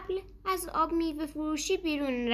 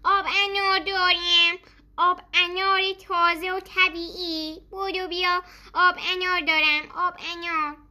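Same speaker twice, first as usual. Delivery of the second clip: high, loud hawking cry; loud vendor call; 6 semitones higher, 4 dB louder than usual. A narrator's reading. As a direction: shout it across the street to sell. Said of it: "با صدای بلند گفت".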